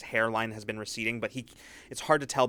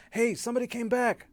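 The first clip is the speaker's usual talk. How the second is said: crowd shout; 13 semitones higher than usual. Acted. Camp crowd calls out that someone returned, high shout. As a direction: yell it out across the camp.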